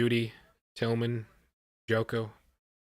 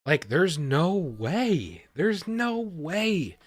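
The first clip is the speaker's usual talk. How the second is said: very slow, stretched-out, higher NO WAY; drawn-out disbelief; 7 semitones higher, 4 dB louder, much slower than usual. Real, not acted. Fan dreading the Dolphins' next pick, drawn-out disbelief. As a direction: draw each word out in pleading disbelief.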